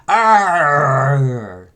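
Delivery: loud, drawn-out growling "arr"; mock battle cry; charging yell. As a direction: imitate a charge through a crowd. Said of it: "he starts running through everybody's".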